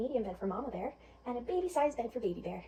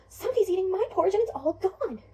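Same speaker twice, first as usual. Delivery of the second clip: high, loud little cub voice; Baby Bear; 7 semitones higher, 8 dB louder than usual. Acted. Little bear finding his bowl empty, high and upset. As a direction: wail it like an upset small child. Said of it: "Baby Bear looks at his and says".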